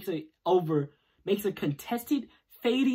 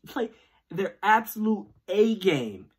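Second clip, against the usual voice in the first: ordinary talk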